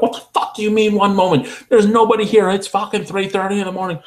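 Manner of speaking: high, mock drunk customer ranting; imitating a drunk customer yelling through the speaker. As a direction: rant like an angry drunk at the drive-thru. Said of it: "They're like".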